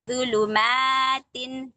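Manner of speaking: ज़ुलुमातिन read very slowly; slow recitation. Shown as a demonstration. Teacher modelling a hard word for students. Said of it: "ये पढ़िए".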